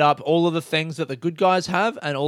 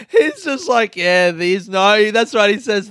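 laughing; 6 semitones higher, 7 dB louder, slower than usual. Real, not acted. Still laughing.

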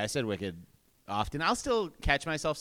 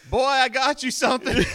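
mock dad voice, higher and louder, laughing through it; imitating gruff dad; 12 semitones higher, 8 dB louder than usual. Son retelling how dad gave the gift, mock gruff dad voice. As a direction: ham up a gruff father handing over a present.

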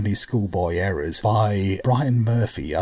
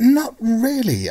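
drawn-out not really; 6 dB louder, much slower than usual. Real, not acted. Drawn-out answer to question.

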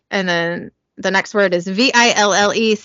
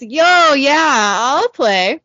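put-on drawn-up, higher drunken holler; mildly hammed-up drunk volunteering; playing drunk; 5 semitones higher, much slower than usual. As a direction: lightly act a drunk butting in. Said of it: "drunkenly staggers over to the pool table".

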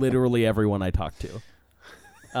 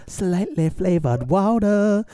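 slow, higher seductive ad read; sultry voice; 8 semitones higher, 4 dB louder, slower than usual. Acted. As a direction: purr it like a commercial. Jingle line, slow.

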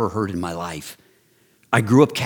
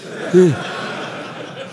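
amused laugh; 7 semitones higher than usual. Real, not acted. Laughs at his own story.

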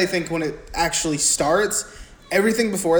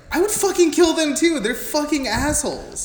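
mock outrage; 10 semitones higher than usual. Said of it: "I was like".

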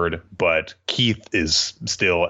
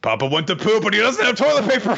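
put-on high, fast little-girl blurt; Anya voice; 3 dB louder, faster than usual. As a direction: squeak the excuse out quickly like a small child. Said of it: "very sadly over to Yor and is like".

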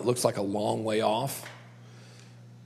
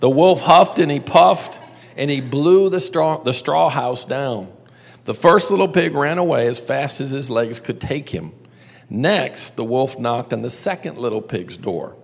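lively read-aloud for a group, lingering on the big bad wolf; storytelling narration; 3 semitones higher, 8 dB louder than usual. A narrator's reading. Teacher reading the three little pigs to the class, lively storytelling. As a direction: tell it like a bedtime tale to a room of kids.

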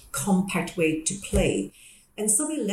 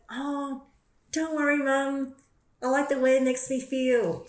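put-on high, sweet child's voice; a young boy cheerfully reassuring his mum; little boy voice; 7 semitones higher than usual. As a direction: mum imitating her small son, innocently. Mum retells young son's reply.

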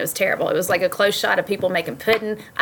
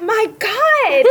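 high cry of shock; mock horrified; 14 semitones higher, 4 dB louder than usual. Husband Rod horrified by the show, high mock cry. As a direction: wail it in disbelief. Rod's retold horrified reaction.

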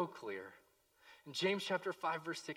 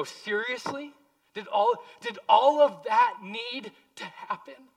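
much higher, louder disbelieving question; incredulous reader; 10 semitones higher, 13 dB louder than usual. Retold or imitated. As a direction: react in mock shock to the ending. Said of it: "we read it and go".

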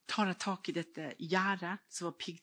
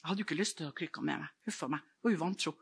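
normal talking voice

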